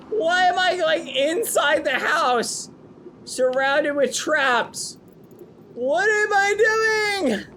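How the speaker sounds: high stretched panicking voice; panicked high whine. Acted. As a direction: wail at your own foolishness. Panicked self-scolding.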